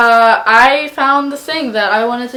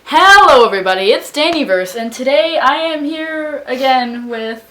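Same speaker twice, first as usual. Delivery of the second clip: vlog intro; 4 semitones higher, 4 dB louder than usual. Real, not acted.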